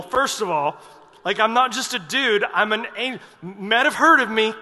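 loud, high, offended angel ranting; the insulted archangel sets him straight; indignant angel Gabriel. Retold or imitated. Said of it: "He's just like".

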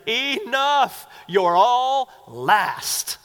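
loud, high, drawn-out exasperation; mock fed-up outburst. Us in Jesus' place ending the argument, mock exasperated shout. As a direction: bellow it slowly like you've had it. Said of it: "We would be like".

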